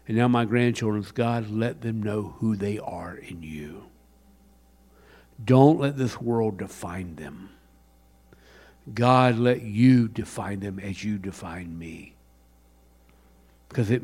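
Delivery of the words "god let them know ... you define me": soft prayer. Retold or imitated. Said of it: "One of the things I pray".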